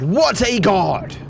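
slow high stretched "what a god"; drawn-out boast. Real, not acted. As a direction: savor the brag. Repeating boast.

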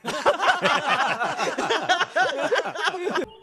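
long, high-pitched cackle; high, drawn-out laughing fit. Real, not acted. Laughing after the meetup exchange.

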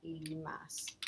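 ordinary talk

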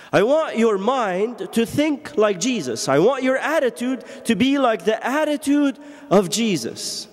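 high, ringing preacher's voice, slowing on the verse; full-force preaching. Acted. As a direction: preach it out to the congregation.

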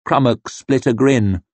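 usual speaking voice

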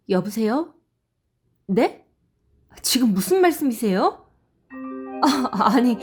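ordinary delivery, nothing marked

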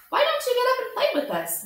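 a chirpy rabbit inviting a friend to play; eager young rabbit. A narrator's reading. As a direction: bounce it out like a friendly little rabbit. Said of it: "one of them asked".

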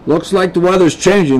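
worried-about-weather remark, not hushed; muttering man. A narrator's reading. Man remarks on the changing weather, muttering. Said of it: "the man muttered".

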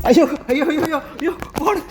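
high hammed-up ayyo cries; mock alarm. Grandma pretending to fear Lachu's beating, hammed-up ayyo. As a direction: pretend to be scared for the child.

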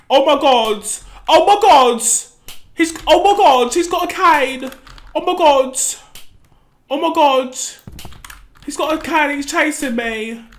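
loud frantic screams of panic; panicked yelling. Real, not acted. Player chased by a man with a cane, panicked shouting. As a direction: yell in terror as you run.

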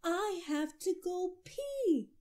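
high, loud and slow wail; yelling child. A narrator's reading. Little boy yelling from the back seat, high loud wail. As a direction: yell it like an urgent little boy. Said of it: "Andrew yelled".

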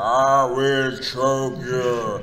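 very slow, loud giant's threat; Andre the Giant. Acted. Performer threatening as the wrestler, slow loud giant. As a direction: stretch each word as a menacing giant wrestler. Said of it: "Andre the Giant".

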